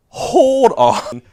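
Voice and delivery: sharp, high, loud startled halt; stunned hold on. Retold or imitated. Teacher halting the class after a shocking answer, loud and high. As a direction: stop everything in disbelief.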